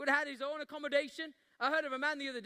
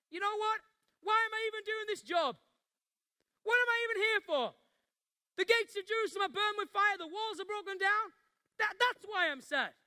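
a voice cracking with burden over broken walls; anguished outburst; 7 semitones higher than usual. Acted. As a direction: let the grief burst out at the king. Nehemiah pours out his burden.

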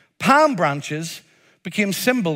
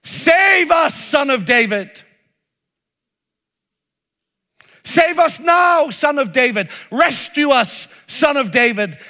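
desperate cry for rescue; 5 semitones higher than usual. Shown as a demonstration. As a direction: cry out to be saved. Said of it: "But here's the other way".

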